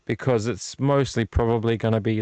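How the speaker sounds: ordinary talk, nothing marked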